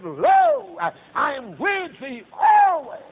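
high, loud, drawn-out holler; chant-shout each phrase slowly to a peak; full-force revival preacher; climactic preaching. Acted.

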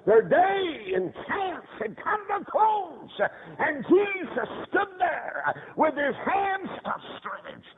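high, slow, drawn-out preacher's cry; impassioned preaching. Real, not acted. Preacher mourning the chance that closed, impassioned. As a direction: stretch each phrase out high.

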